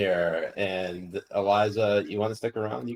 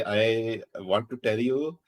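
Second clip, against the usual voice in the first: put-on Bajan accent, rising louder to the end; mock Bajan accent; 4 semitones higher, 7 dB louder than usual. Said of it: "she did Baijan accent".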